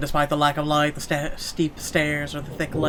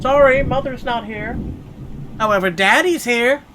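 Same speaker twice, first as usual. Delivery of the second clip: high, louder mock-sinister daddy; creepy daddy voice; 10 semitones higher, 6 dB louder than usual. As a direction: tease the ghost as a creepy dad. Creepy joke reply to ghost.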